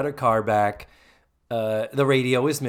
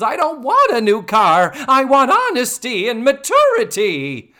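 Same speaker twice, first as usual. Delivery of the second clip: higher and louder, indignant; indignant refusal; 11 semitones higher, 9 dB louder than usual. Retold or imitated. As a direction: mimic a hurt, huffy teacher turning down the gift. Teacher refuses the new car.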